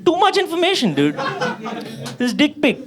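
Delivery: high-pitched, hammed-up comic disgust; appalled rant. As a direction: rant in mock horror at too much information. Appalled rant about the picture.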